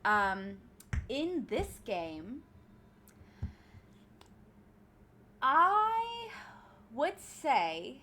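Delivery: put-on British accent, chatting along; casual imitation British voice. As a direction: keep up a playful British accent. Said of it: "say that with an accent".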